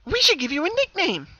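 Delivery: high, loud, chatty; happy Pinkie explaining to her double, chatty; explaining brightly. Acted. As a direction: work it out aloud, bouncy. Happy twin proposes nickname.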